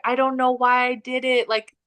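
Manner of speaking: a hint of a guilty confession to a scolding friend; helpless excuse. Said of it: "And I was like".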